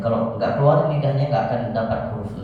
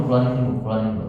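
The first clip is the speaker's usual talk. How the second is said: quick urging; 2 semitones lower, much faster than usual. Shown as a demonstration. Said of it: "urging repeated".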